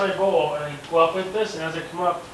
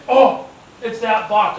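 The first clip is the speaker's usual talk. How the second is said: higher, louder and drawn out with awe; slow excited exclaim; 7 semitones higher, 8 dB louder, much slower than usual. Retold or imitated. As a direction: savour each word in thrilled disbelief. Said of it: "I'm like".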